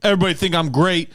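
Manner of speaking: fast, loud boastful show-off imitation; hammed-up bragging guy; mock braggart. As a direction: strut through it like a cocky loudmouth. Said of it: "he was like".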